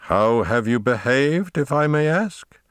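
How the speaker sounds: slow question climbing high; imperious, deliberate, rising to the end; cold, harsh interrogation. A narrator's reading. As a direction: demand an answer like a stern commander. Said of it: "dreadfully cold and harsh glare and tone".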